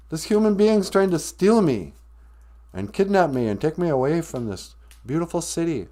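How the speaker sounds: high, hammed-up complaint easing off; distressed plea. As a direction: plead loudly to be rescued, then ease down. Goose keeps pleading for rescue.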